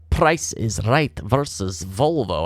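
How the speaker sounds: slow, drawn-out mock Scottish announcement; Scottish-sounding accent. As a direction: slip into a playful Scottish lilt. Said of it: "talking with some Scottish sounding accent".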